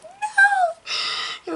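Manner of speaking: very high, loud wail of no; despairing no. Retold or imitated. Fan reliving Spider-Man dissolving, wailing no. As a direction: wail it at the screen. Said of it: "and I'm like".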